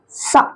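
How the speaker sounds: "सा" as a short "sha" sound. Shown as a demonstration. Teacher showing CH's other sound, "सा" said as short sha. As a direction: give "सा" alone as a hushing sh sound. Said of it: "यहाँ सीएच का उच्चारण क्या है".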